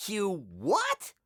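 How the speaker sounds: high, incredulous outburst; shocked cry. A narrator's reading. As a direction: cry out in disbelief, pitch jumping up. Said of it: "cried Harold".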